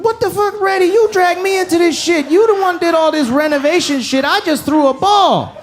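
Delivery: panicked protest. Retold or imitated. Friend blaming Phil before mom's whooping, high panicked protest. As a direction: squeal out the blame in panic.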